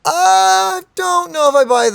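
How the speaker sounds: loud mock disbelief; skeptical. Real, not acted. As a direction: scoff at the excuse. Streamer doubts game character's excuse.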